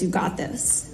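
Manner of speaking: low, near-whispered encouragement; soft imitated pep talk, voice dropped; quiet reassurance. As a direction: reassure under your breath. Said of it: "Caleb looks at me and says".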